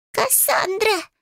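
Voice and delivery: very high, drawn-out owl shriek; shrill panicked creature cry, stretched out; terrified wail. A narrator's reading. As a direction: wail the name in pure terror. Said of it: "полный ужаса вопль белки".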